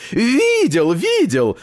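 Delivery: high, delighted tailor's voice; joyful exclamation. A narrator's reading. Tailor answers grandfather's question, joyful exclamation. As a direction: exclaim it happily, pitched right up. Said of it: "радостно воскликнул портной".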